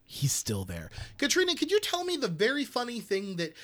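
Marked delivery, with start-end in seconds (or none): 0.1-0.9 very low-pitched repetition; low insistence. Real, not acted. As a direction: drop the voice deep and repeat. Low repeated insistence after announcement.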